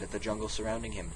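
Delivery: ordinary delivery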